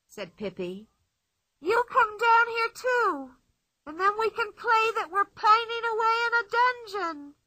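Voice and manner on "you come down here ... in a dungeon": put-on playful girl boasting from inside the tree; Pippi's voice. A narrator's reading.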